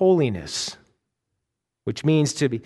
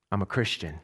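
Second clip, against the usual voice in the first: quiet reflection; 7 semitones lower, 9 dB quieter than usual. Acted. Reflective echo of Tim's line.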